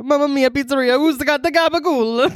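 cartoon Italian accent, rising in pitch. Host showing off to friends, exaggerated mock Italian accent. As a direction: ham it up as a comic Italian. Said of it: "I wish you did talk like that".

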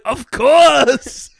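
loud, high hammed-up action hero; over-the-top triumphant reply; Arnold Schwarzenegger. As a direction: boom it out as a movie hero. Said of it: "He's like".

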